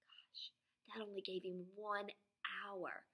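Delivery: whispered worried thought; a boy whispering to himself. Acted. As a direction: breathe it out as a secret worry. Andrew's worried thought in story.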